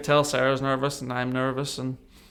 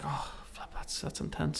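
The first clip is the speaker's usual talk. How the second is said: stunned whisper; 9 dB quieter than usual. Retold or imitated. Reacting to treatment cost news.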